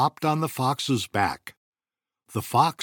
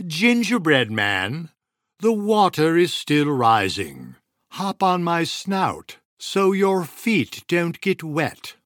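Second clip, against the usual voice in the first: sly fox; 7 semitones higher, 4 dB louder than usual. A narrator's reading.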